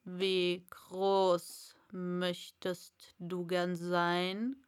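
low, slow caterpillar question. A narrator's reading. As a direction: drawl it out lazily from above. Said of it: "Die Raupe sprach zuerst wieder".

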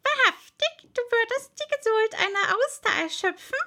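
high, loud indignant toad voice; indignant old toad. A narrator's reading. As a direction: exclaim like an outraged old toad.

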